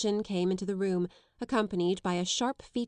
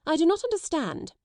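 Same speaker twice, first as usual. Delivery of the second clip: stiff, raised, a little louder; offended headmistress, stiff and higher; stiffly offended; 9 semitones higher, 4 dB louder than usual. Acted. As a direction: draw yourself up, affronted headmistress. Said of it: "she said stiffly".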